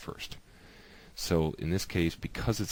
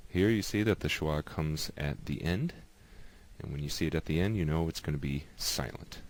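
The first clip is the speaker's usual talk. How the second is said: ordinary talk, nothing marked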